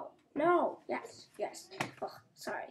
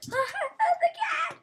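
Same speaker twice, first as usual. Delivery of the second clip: laughing shout; 4 dB louder than usual. Real, not acted. Laughing shout at chasing cat.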